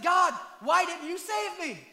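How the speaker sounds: loud, slow, aggrieved demand; indignant complaint. Retold or imitated. Dead man in heaven demanding why God failed him. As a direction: demand an answer from God, wounded.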